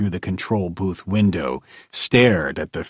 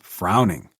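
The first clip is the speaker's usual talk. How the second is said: ordinary delivery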